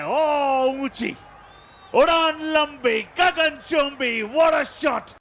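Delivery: high, loud, drawn-out soaring call; excited six call. Real, not acted. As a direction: stretch it out as the ball sails. Commentator calls a huge six.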